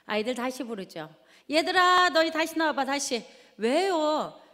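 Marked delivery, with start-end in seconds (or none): 1.5-3.2 loud, high call across the house. Retold or imitated. Mother calling kids back out, loud and high. 3.6-4.4 put-on higher voice of a child; child's reply. Child answering mother's call, higher voice.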